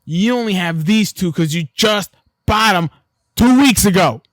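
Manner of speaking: loud, slow accusing push; rising up to shout him down; heated. Real, not acted. Friend accusing friend of drinking, loud and heated. Arguing over who drinks more.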